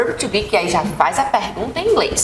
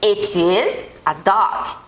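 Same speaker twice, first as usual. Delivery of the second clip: slow model sentence; 2 semitones lower, much slower than usual. Shown as a demonstration. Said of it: "ele é um cachorro".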